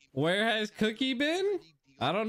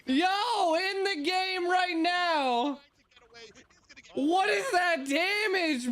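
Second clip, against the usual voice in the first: excited; 8 semitones higher, much slower than usual. Real, not acted. Hype over a teammate's big play.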